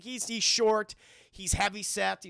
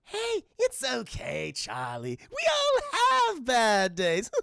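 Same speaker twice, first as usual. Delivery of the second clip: Joker; 8 semitones higher, slower than usual. Acted. Answering poor Charlie's apology, mockingly soothing. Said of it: "Joker's like".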